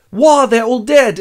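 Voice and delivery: high, loud, slow wail; hammed-up cry of shock; mock horror. Fellowship finds Moria's dwarfs dead, high mock horror.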